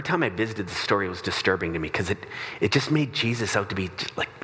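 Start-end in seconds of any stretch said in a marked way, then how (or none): none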